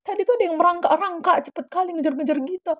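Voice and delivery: high, agitated report; retold alarm. Friend telling rider what was chasing, high alarmed voice. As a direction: blurt out the sighting in mock fright.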